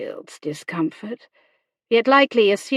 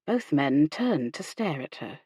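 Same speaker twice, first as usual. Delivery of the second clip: usual speaking voice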